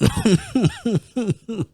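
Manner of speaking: a burst of high, squeaky laughter; high squealing laugh. Real, not acted. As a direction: let out a shrill giggle of disbelief. Laughs at own joke.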